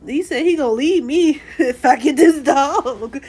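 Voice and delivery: laughing through the words. Real, not acted. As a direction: giggle while telling it. Laughing while retelling threat.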